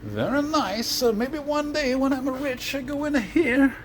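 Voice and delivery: very high, drawn-out silly voice; put-on admirer voice. Admiring a fancy building while filming, high comic voice. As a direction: play a wistful dreamer in a high, slow voice.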